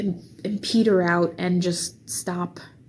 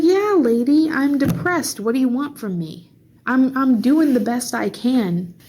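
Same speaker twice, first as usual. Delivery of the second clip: loud, high, fed-up comeback; exasperated retort; 5 semitones higher, 3 dB louder than usual. Retold or imitated. Snapping back at the lady who called her gloomy, loud and exasperated. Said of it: "It's like".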